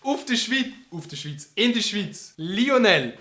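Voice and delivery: high, loud parody shouting; mock shrieking. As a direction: ham up the friend's words. Mocking friend again. (spöttisch nachäffend)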